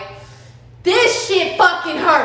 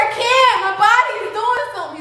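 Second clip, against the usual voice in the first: panicked plea; 6 semitones higher, faster than usual. Retold or imitated. Pleading to paramedic.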